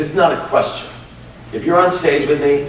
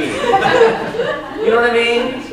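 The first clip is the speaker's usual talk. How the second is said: high, slow, laughing question; much slower than usual. Real, not acted. Host to guest, laughing question. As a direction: let the laugh carry the whole line. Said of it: "laughing through the question".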